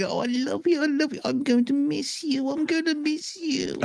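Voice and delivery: put-on very high, doting little old lady voice; cooing old lady. Old lady gushing over the dog in a very high coo. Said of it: "this little old lady".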